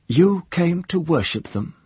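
high jeering sneer; mock his devotion openly. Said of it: "she said, jeering".

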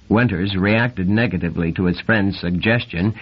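ordinary delivery

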